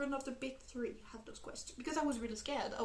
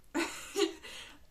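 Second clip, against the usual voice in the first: breathy giggle; 5 semitones higher than usual. Real, not acted. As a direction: laugh it off, embarrassed. Giggles after heart melting.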